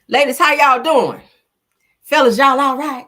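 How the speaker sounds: big, loud soulful greeting to the chat. Pretend. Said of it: "in my [name] voice".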